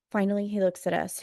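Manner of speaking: ordinary delivery, nothing marked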